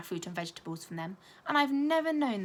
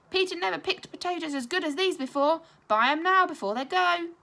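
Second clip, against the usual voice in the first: louder, higher trader's call; market shout; 9 semitones higher, 8 dB louder than usual. Acted. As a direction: sell the potatoes to the whole market.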